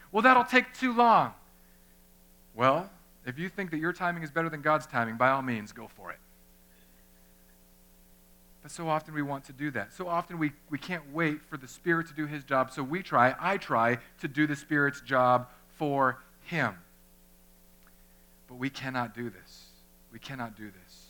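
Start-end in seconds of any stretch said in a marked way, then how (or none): 0.1-1.3 high, slow, loud mock complaint; a put-upon objection, drawn out and pitched up; impatient retort. People's impatient reply to prayer. 20.1-21.1 much softer, low echo; soft solemn repeat; quiet. Shown as a demonstration. Repeats line, second much softer.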